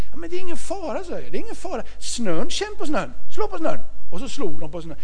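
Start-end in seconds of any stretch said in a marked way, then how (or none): 0.1-1.0 much higher, eager reassuring voice; coaxing high. Retold or imitated. Pappa övertalar, mycket högre röst. 1.3-3.8 much higher, eager reassuring voice; coaxing high. Retold or imitated. Pappa övertalar barnen.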